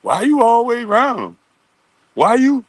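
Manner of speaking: high, imitated pestering questions; mock questioning. Men asking girls why him, high mock questioning.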